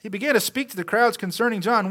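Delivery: ordinary delivery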